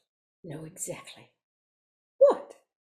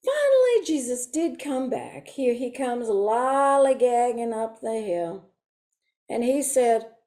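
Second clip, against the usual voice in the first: usual speaking voice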